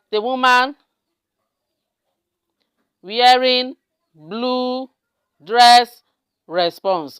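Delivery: drawn-out typing voice; level, held words with pauses between; slow dictation. Real, not acted. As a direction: say one word at a time as you type.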